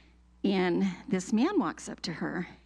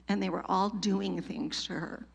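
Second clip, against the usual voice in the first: normal talking voice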